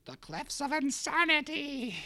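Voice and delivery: high, loud, drawn-out dramatic cry; theatrical proclamation. Acted. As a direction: proclaim it slowly with mock grandeur. Bursts in with dramatic movie title.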